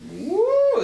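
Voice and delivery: high loud cheer; excited whoop. Real, not acted. Celebrating flight.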